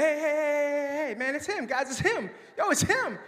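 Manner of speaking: high, loud, excited calling out; frantic high-pitched yelling to the whole group. Retold or imitated. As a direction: shout it like you spotted a celebrity. Excitedly pointing someone out.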